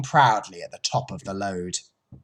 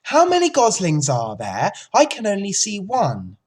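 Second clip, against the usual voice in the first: higher, louder eager questions; eager Wilbur; 8 semitones higher, 5 dB louder than usual. A narrator's reading. Wilbur asking the goose about the goslings, eager. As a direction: ask eagerly like an excited pig.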